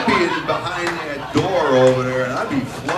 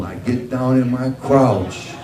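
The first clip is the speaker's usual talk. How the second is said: normal talking voice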